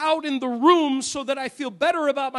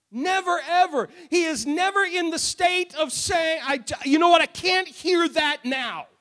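higher-pitched, emphatic preaching; full-force preaching; 6 semitones higher than usual. Acted. Preacher assuring congregation God is never unwilling, full-force preaching. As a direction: drive each phrase home to the congregation.

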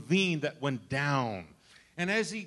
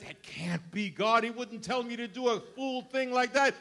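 incredulous; 8 semitones higher, 4 dB louder than usual. Retold or imitated. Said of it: "the man said".

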